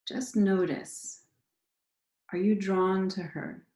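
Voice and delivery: unhurried meditation leader, pausing after each prompt; guided meditation. Acted. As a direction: guide listeners gently into their bodies, leaving space between phrases.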